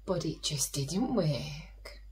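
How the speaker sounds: slow, gentle read-aloud storytelling; storytelling to children. A narrator's reading. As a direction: tell it like a bedtime story for little ones.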